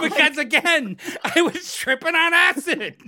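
loud, high-pitched comic reminder; exasperated shout. Real, not acted. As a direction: yell the obvious explanation. Exasperated answer to why.

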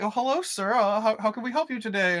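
high, sugary mock greeting; fake politeness. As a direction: put on an innocent host voice. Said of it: "they're like".

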